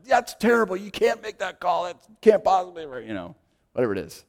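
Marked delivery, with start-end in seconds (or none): 0.1-2.8 loud, high-pitched mock protest; worked-up coach. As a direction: imitate an irate coach arguing a call. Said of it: "doesn't tend to slow down their emotional state".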